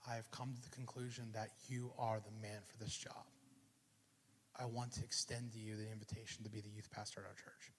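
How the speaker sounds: pastor's words whispered; hushed offer. Retold or imitated. Retelling the pastor's job offer to the congregation, whispered. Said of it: "and he goes".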